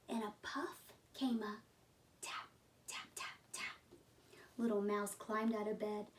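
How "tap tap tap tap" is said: soft whispered tapping sound; whispered taps. A narrator's reading. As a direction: breathe the taps out barely audible.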